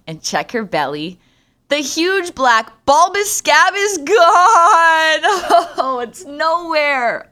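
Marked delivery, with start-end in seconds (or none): 1.7-7.3 loud, high, laughing horror; horrified disbelief. Real, not acted. Finding the scab gone, high horrified laugh. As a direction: shriek it out half laughing.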